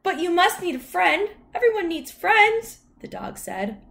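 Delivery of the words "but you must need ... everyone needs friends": very high, loud dog voice; high, eager talking dog; friendly dog. A narrator's reading. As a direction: insist eagerly in a high puppyish voice. Dog insists on being friends.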